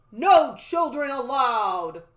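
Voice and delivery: loud, slow stern guard voice; booming, drawn-out command; stiff guard. A narrator's reading. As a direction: order it like a rigid sentry. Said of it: "he said stiffly".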